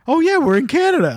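high, delighted realization; mock surprise at Canadian calm. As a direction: pitch up as it dawns on you. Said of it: "You're not mad?".

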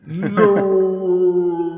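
long drawn-out, high, loud wail; hammed-up movie cry of "Nooo"; mock anguished "No". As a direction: wail one endless "no" in mock agony. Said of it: "the moment with the".

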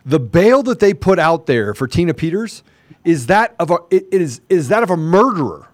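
indignant outrage. Real, not acted. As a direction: build in pitch with real indignation, hitting key words. Outraged at bail set for Peters.

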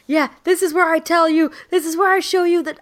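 high, fast mock-heroic boast; boastful warrior. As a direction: brag like a triumphant gamer warrior. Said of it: "I was like".

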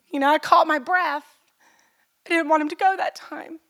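fighting back tears while speaking; choked up. Real, not acted. Mother tells church about son leaving, near tears. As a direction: voice breaking on a sigh, pitch rising. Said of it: "I don't know why I'm crying".

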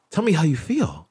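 high, gentle calming voice; calm, open question. Retold or imitated. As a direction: soothe an upset client, mock-sweet. Calm reply to a yelling client.

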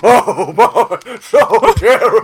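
high, loud words through laughter; laughing mock horror. Real, not acted. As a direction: laugh through feigned terror. Laughing after his own story.

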